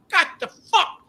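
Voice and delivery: high, loud, laughing "what the fuck"; mock outraged yell. Guest complaining to hotel staff, mock outraged high yell. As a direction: play up the outrage, half laughing.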